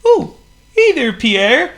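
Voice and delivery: high, loud squeaky penguin; a gloomy little penguin sighs. A narrator's reading. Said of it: "greeted Waldo Waddle".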